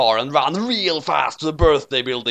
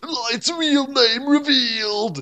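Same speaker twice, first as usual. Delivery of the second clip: high, slow movie trailer announcement; mock trailer voice; 11 semitones higher, slower than usual. Author plugs the next story, high slow trailer voice. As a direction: hype it like an ad.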